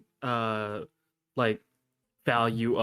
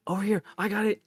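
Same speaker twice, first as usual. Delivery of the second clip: higher, keen little dwarf voice; dwarf begging to babysit, higher and keen; eager dwarf; 7 semitones higher, faster than usual. Acted. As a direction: play the dwarf eagerly volunteering.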